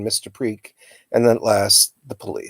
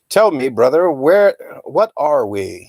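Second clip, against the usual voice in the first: bellowing; 4 semitones higher, 3 dB louder than usual. A narrator's reading. Uncle bellows at Mister Preak in the water, booming. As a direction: roar it across the water like a madman. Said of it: "he bellowed at Mister Preak".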